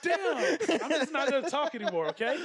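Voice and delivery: high, laughing imitation of backing out; laughing mock retreat. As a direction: act out giving up, laughing through the words. Retold awkward retreat, through laughter.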